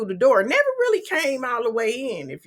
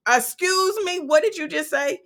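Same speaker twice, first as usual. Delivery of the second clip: imitating a stunned sister, much higher; shocked disbelief; 8 semitones higher than usual. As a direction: retell her shocked "Excuse me" pitched way up. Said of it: "And then Niecy says".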